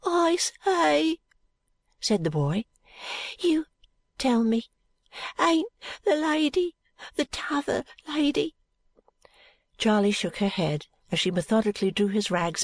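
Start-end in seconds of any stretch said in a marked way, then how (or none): 0.0-1.2 put-on high, drawn-out boy's voice; sick boy. A narrator's reading. Sick boy addresses Charley. 3.4-8.5 put-on high, halting boy's voice; questioning sick boy. A narrator's reading.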